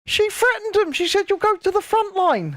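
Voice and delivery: high-pitched protesting retelling; emphatic insistence. Real, not acted. Insisting against repeated contradiction.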